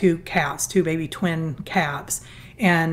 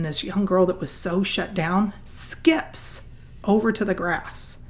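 ordinary talk, nothing marked

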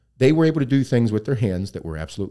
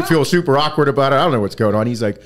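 ordinary talk

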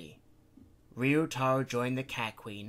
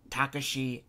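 usual speaking voice

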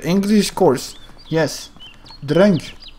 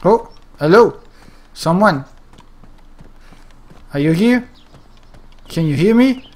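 calling out; 4 dB louder than usual. Acted. Calling out to other players.

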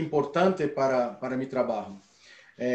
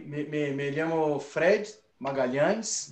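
usual speaking voice

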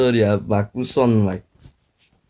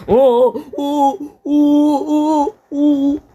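high excited hoots; 4 dB louder, much slower than usual. Real, not acted. Excited shouts during gameplay.

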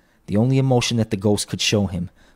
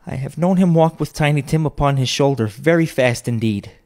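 higher, louder, determinedly cheerful; steady cheerful voice; 6 semitones higher, 5 dB louder than usual. A narrator's reading. Mother recalls Tiny Tim on his shoulder, steadily cheerful. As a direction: push on brightly, slowing on the last words. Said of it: "in a steady, cheerful voice".

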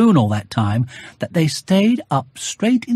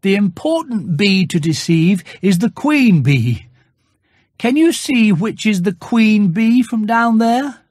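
higher, earnest little bear voice; a small bear making a serious plan, voiced higher; Pooh's voice; 7 semitones higher than usual. Pooh voice in the story.

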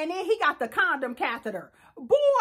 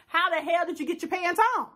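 usual speaking voice

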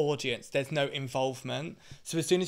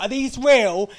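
high, loud imitation of a nosy punter; mock brazen excitement; 9 semitones higher, 11 dB louder, slower than usual. Audience member grabbing the drag queen, mock excited. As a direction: squeal it like a tipsy fan.

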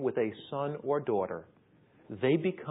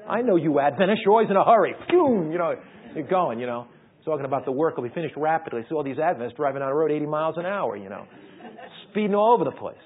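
louder, fast mock-rushed patter; playful teasing; 2 semitones higher, 8 dB louder, faster than usual. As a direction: rib the hurried crowd, speeding up. Teasing hurried Adventists.

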